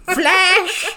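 high, slow doting grandma; sweet granny voice. Acted. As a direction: coo it like a sweet old granny.

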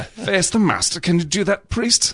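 a lightly voiced James Mason taunt; James Mason impression. Trying the James Mason voice again for friends. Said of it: "that's not my best version of it".

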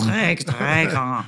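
slow, drawn-out fake French; mock French accent. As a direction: echo it slowly in hammy French. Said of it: "With a terrible accent".

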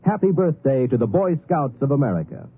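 usual speaking voice

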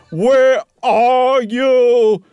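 very slow, stretched-out loud call; drawn-out searching shout. A narrator's reading. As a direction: holler down an empty house, high and long. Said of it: "he called out".

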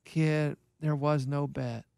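low, slow, hushed coach impression; drawn-out, deadpan phone voice, imitated; Keith. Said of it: "no, Keith".

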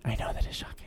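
breathy conspiratorial whisper. Real, not acted. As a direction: hush it like gossip. Mock scandalized whisper.

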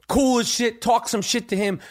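ordinary talk

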